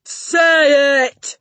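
high, drawn-out roar; bellowing Trunchbull. A narrator's reading. Headmistress demanding the answer from the boy, roaring. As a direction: roar the order at full volume. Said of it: "bellowed the Trunchbull".

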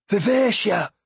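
gasped name, pitched high; shocked gasp. Acted. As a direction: cry out in shock. Althea gasps ship's name.

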